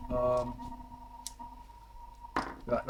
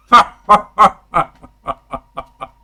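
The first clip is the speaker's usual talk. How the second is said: loud, spaced-out ha-has; big staged villain laugh, dying away; king's mocking laugh; 6 semitones higher, 17 dB louder than usual. Game master voicing the gloating king, booming laugh. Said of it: "As the king goes".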